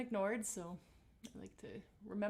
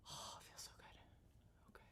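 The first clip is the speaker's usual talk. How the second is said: whispered pleasure; 22 dB quieter, faster than usual. Real, not acted. Teacher enjoying her own face massage, whispered. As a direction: breathe it out, no voice.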